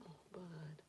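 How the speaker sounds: hushed whisper to a pet. Real, not acted. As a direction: barely breathe it to the bunny. Cooing softly to the rabbit.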